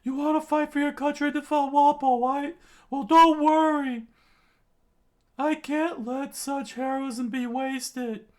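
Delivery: very high, loud impassioned cry; determined yell. Acted. Usopp vowing to help Dalton, high impassioned yell. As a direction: shout your promise with full effort. Usopp's rousing promise while straining.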